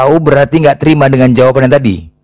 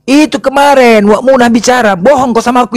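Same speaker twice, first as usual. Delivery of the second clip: accusing driver; 9 semitones higher than usual. Acted. Driver accuses seller of lying.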